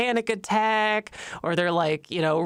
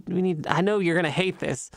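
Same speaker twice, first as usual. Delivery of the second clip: ordinary talk, nothing marked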